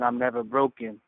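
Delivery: normal talking voice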